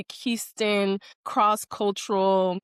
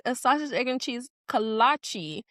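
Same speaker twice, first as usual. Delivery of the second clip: slower careful repeat; 5 semitones higher than usual. Retold or imitated. Repeating a breakfast order for a puzzled roommate, slowed down. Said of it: "A what?".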